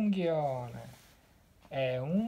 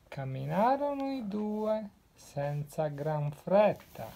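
ordinary delivery, nothing marked

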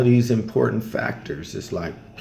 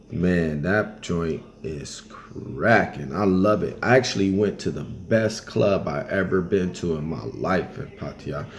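ordinary talk, nothing marked

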